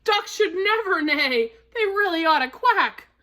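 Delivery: high, whinnying horse voice; stunned horse. A narrator's reading. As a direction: speak as an astonished horse. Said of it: "Hank the horse was stunned".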